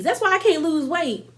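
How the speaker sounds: ordinary delivery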